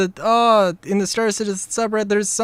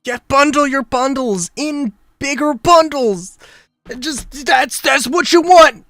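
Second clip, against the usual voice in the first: loud, mocking, higher-pitched sales pitch; mock the studio's greed out loud; sarcastic rant; 7 semitones higher, 6 dB louder than usual. Streamer mocking the studio's bundles, loud and sarcastic.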